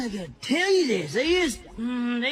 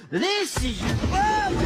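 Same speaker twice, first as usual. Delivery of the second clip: higher, playful jab; teasing; 7 semitones higher than usual. Acted. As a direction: tease her with a gleeful poke. Teasing sister, grabbing her.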